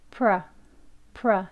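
"pro" reduced to a short "pruh"; weak schwa. Shown as a demonstration. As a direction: say pro weakly, with an "uh" vowel. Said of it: "약하게". (pro를 약하게 '프러'로)

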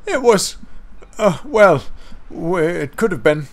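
high, stammering, frightened little voice; quavering. A narrator's reading. Percy telling Toby about a monster, high nervous quaver. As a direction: quaver and falter towards the dreadful word. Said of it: "quavered Percy".

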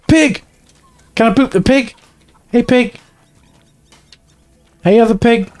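high, excited squeal; excited calling out. Real, not acted. Player greeting pigs to boop in a game, excited high call.

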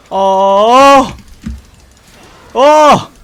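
loud high groans of dismay; anguished shout. Real, not acted. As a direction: cry out in despair. Enemy pentakill coming.

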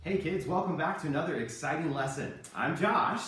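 normal talking voice

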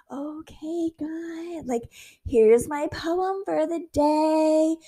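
half-mocking prayer-poem voice; mock formal prayer. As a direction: recite a stiff little poem. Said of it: "be like".

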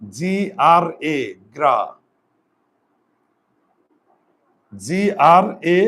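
spelling out letters slowly and firmly. Shown as a demonstration. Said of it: "গ্রাস". (জি আর এ ধীরে ধীরে বানান করে)

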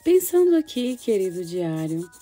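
put-on gentle storytelling for children; story narration. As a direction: read it like a child's diary. Reads girl's diary story.

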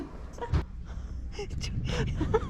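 high unvoiced nervous laugh; scared laugh with held breath; nervous breathy laugh. Real, not acted. Scared on ski lift.

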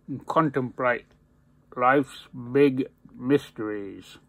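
talking around pipe stem; pipe in mouth. Real, not acted. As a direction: teeth clenched on pipe. Seen 0: Pipe stem held in his teeth throughout while he speaks.

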